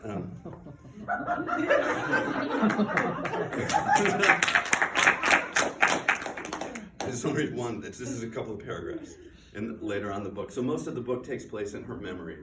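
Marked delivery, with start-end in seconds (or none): 0.4-6.9 long, airy, helpless laugh rising at the end; can't stop laughing, let it run out; long breathless laugh. Real, not acted. Laughter after failed reading.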